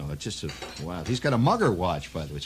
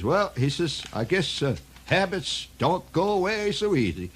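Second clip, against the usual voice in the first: lightly voiced Irish brogue, much higher; old Irish cop; 9 semitones higher than usual. Old Irish cop tells the narrator his sorrows, brogue. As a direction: play the weary old Irish beat cop.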